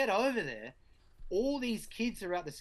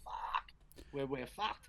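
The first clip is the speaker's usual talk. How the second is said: resigned despair; 2 semitones lower, 6 dB quieter, slower than usual. Retold or imitated.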